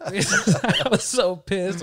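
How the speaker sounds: "I was so pissed" said through real laughter, slow; laughing it out as he remembers. Real, not acted. Laughing while retelling.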